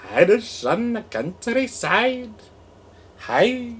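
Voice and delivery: high, hammed-up Irish accent; mock Irish accent. Host playing with a mock Irish accent, loud and high. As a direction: loose mock brogue calling hello.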